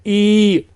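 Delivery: ई held for three beats; extra-long i. Shown as a demonstration. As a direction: stretch the vowel ई long. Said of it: "उसी को थोड़ा सा और खींच लीजिए". (ई को तीन मात्रा तक खींचकर)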